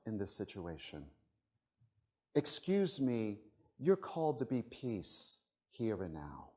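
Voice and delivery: very soft, hushed appeal with spaces between phrases; a quiet voice tapping someone on the shoulder; softly coax, dropping low at phrase ends; gentle nudging. Acted. Voices life gently nudging listeners.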